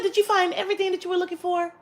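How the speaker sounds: high, quick customer-service voice; light, high-pitched clerk impression; polite cashier. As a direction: imitate a perky cashier's routine question.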